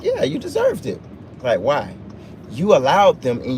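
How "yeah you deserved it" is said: a high, dismissive little quip; blunt mock reply. Retelling his answer to a whining friend, high and dismissive. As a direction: toss it off, mocking the friend.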